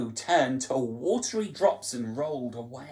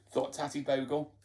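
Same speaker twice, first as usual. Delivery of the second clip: ordinary delivery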